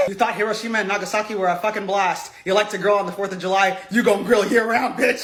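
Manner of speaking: mock Putin threat. Mocking Putin warning the USA, low and menacing. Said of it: "he was trying to say".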